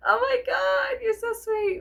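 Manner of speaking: high gushing sweetness. Retold or imitated. Thanking her husband for the compliment: high gushing. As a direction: gush over his kindness. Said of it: "I was all like".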